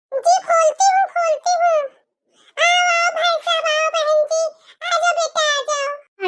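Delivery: welcoming mother. Acted. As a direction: greet the groom's family at the door.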